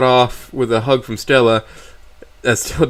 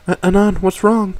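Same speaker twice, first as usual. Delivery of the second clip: lightly voiced concerned girlfriend; gentle girl voice; 7 semitones higher than usual. A narrator's reading. Stella voiced in story.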